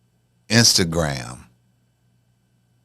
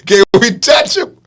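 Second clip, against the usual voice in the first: put-on higher and louder girlish question; mock girl asking to pet it; imitating a girl; 10 semitones higher, 5 dB louder than usual. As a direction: ask like an excited kid. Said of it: "she say".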